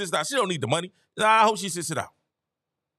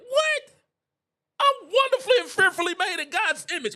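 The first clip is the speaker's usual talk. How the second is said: incredulous; 9 semitones higher than usual. Real, not acted. Heated rant about humility.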